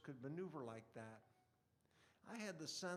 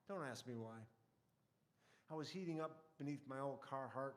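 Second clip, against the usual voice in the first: ordinary talk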